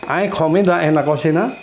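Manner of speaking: "Hay comida en la cocina" asked, with "cocina" tipped upward; question intonation. Shown as a demonstration. Teacher repeats the question for the class, questioning intonation. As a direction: ask "Hay comida en la cocina" with a rising end. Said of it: "Kan itu bertanya".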